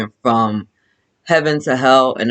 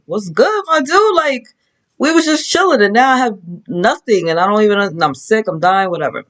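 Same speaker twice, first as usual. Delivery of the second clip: Job pleading; 9 semitones higher than usual. Retold or imitated. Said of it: "He's like".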